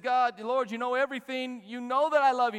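loud, high, impassioned pleading; heartbroken protest. Retold or imitated. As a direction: cry it out as a wounded plea. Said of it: "Peter's heart was broken".

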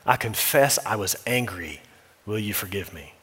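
low, hushed confession that fades to almost nothing; humble confession. Shown as a demonstration. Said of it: "use biblical language to confess".

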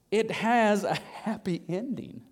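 drawn-out, savoured words; unhurried, spaced-out repetition; slow, deliberate emphasis. Real, not acted. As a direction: let each word land slowly. Repeats it slowly for emphasis.

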